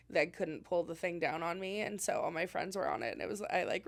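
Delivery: ordinary talk, nothing marked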